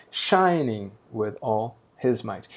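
slow, "shining" in "shining with all his might." hit at the start; natural stress. Shown as a demonstration. Teacher contrasting the real stress with the metrical one, slow.

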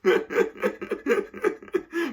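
laughing. Real, not acted. Laughing at thieves' offer.

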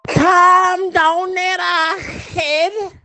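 put-on high, drawn-out Scouse accent; mock Scouse. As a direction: stretch it out like a Liverpool lad. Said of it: "that's how Scouts speak".

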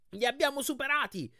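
high, exulting cry; excited high-pitched shout at moderate volume; triumphant shout. A narrator's reading. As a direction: crow it out, pitch leaping up.

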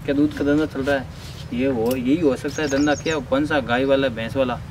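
hushed aside. Acted. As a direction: whisper the plan to colleagues. Officer confers secretly aside. (साथियों से बहुत धीमी, नीची आवाज़ में गुपचुप बात)

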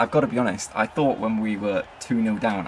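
ordinary talk, nothing marked

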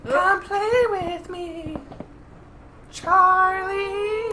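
put-on creepy ghost child. Creepy game voice read.

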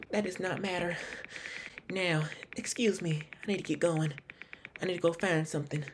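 put-on high Southern accent; [name]. Suspect excusing herself from questioning, in a high Southern accent. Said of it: "she sounds Southern".

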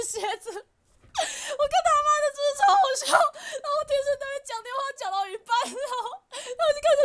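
breaking up with laughter; laughing helplessly at the memory; real laughter. Real, not acted. Laughing hard at story.

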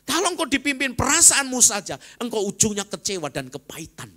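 loud, high preaching climax; fiery sermon peak; preaching at full force. Acted. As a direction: proclaim it to the whole church. Preacher raises voice.

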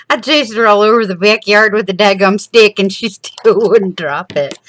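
loud, laughing retelling of the chase; laughing exasperation. Real, not acted. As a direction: laugh through the frustration. Frustrated chase of the dog.

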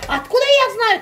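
high, loud mock of the husband's rude reply. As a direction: snap back like the annoyed husband. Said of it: "Таким ещё грубым голосом".

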